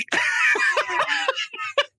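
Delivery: a big high-pitched burst of laughter. Real, not acted. Storyteller laughing at his own wrestling memory, high burst.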